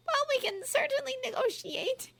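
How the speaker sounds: high, tight, forced politeness; stiffly. A narrator's reading. Umbridge stiffly offering Ron to negotiate, high voice. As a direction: keep it stiff and controlled. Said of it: "said Umbridge stiffly".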